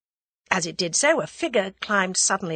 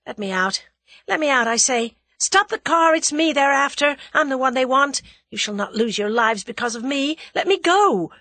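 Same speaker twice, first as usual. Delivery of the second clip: rising high and loud, desperate; girl begging the men to stop the car, frantic; frantic pleading; 7 semitones higher, 5 dB louder than usual. A narrator's reading. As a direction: beg to be let out.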